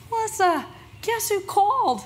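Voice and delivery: high, bright-eyed teasing announcement; excited. Retold or imitated. Partner on the phone, teasing big news, excited and high. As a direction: call out the name with barely held excitement.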